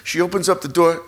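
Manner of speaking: ordinary talk, nothing marked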